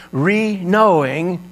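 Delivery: re-knowing stretched out slowly and high, re stressed. Shown as a demonstration. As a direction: draw out re-knowing. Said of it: "recognizing or recognizing".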